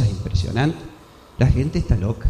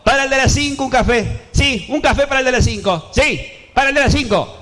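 high sing-song waiter's call; 9 semitones higher than usual. Acted. Waiter calling an order to the kitchen, high sing-song.

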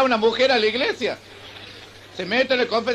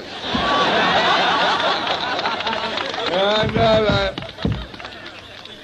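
long laugh; 2 semitones lower than usual. Real, not acted. Teller laughs at own joke.

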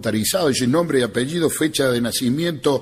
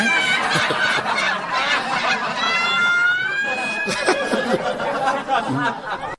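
real burst of shrieking laughter; long high laugh. Real, not acted. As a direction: laugh hard and high at your own joke. Risa larga del cómico. (carcajada aguda)

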